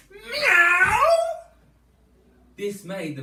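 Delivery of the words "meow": loud, high, slow cat meow; biggest meow. Acted. Teacher leads kids in Mog's loudest, high meow. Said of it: "gave her biggest meow yet".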